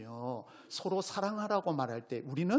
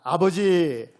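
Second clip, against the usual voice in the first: "아버지" called out loud and full; loud heartfelt call of 아버지; calling father aloud; 11 dB louder, much slower than usual. Shown as a demonstration. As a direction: cry out "아버지" to God. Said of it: "아버지라고 한번 불러보겠습니다".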